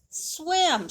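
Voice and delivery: plain reading voice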